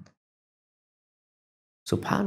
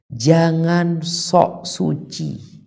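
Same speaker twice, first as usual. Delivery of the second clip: slow scolding rebuke; 5 semitones higher, much slower than usual. Acted. Preacher scolds self-righteousness.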